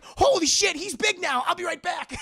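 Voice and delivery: high, loud exclamation; alarmed thrilled teen. Retold or imitated. Imitating Peter seeing a giant Ant-Man, high loud yelling. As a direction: yell it like an astonished kid.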